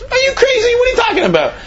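high, wailing cry of disbelief; the robbed rich man shrieking at his driver; outraged rich man. Retold or imitated. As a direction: squeal it like he can't believe his ears.

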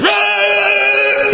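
very high, drawn-out yelled "Rah"; loud screaming roar. Acted. Loud-voiced envoy greeting the queen, screaming roar. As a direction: roar it out at a startling pitch. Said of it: "He had this loud voice".

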